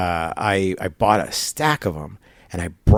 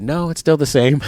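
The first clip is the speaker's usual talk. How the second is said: laughing; 8 semitones higher, 4 dB louder than usual. Retold or imitated. Clerk answers, speaker laughing.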